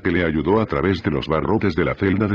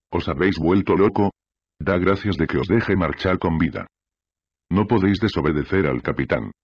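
plain reading voice

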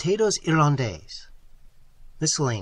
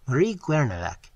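plain reading voice

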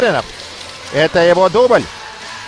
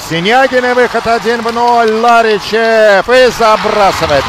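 building louder, higher breakaway call; excited hockey goal call; 5 semitones higher, 6 dB louder than usual. Real, not acted. (нарастающий крик комментатора при голе)

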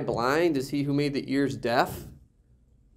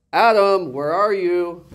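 raised voice, pitched up; calling out; 5 semitones higher, 5 dB louder, slower than usual. Acted. God calls out to Adam in the garden, calling out. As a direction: call out for someone hiding. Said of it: "and so he calls out".

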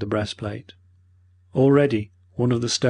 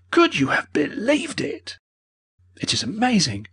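shocked disbelief; 11 semitones higher than usual. A narrator's reading. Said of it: "he cried".

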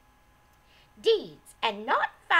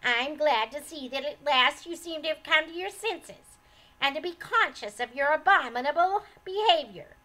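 higher, louder haughty lecture; sharp scolding; 3 semitones higher, 7 dB louder than usual. A narrator's reading. Old lady berating her son's wife, loud and sharp. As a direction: scold her like a stern mother-in-law. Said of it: "snapped the old lady".

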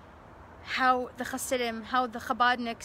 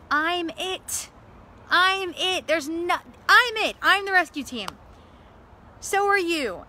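higher and louder, fervent insistence; passionate declaration; 5 semitones higher, 6 dB louder, slower than usual. Real, not acted. Speaker urging listeners to be their own rescue, fervent and loud. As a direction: drive it home to the audience. Rousing climax of her speech.

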